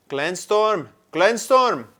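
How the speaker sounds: loud, high call across the glen. A narrator's reading. Badger loudly calling the centaur's name. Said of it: "called again".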